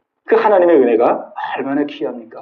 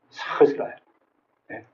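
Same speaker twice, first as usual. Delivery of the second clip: whisper; 6 semitones lower, 12 dB quieter than usual. Acted. Confiding aside about Job.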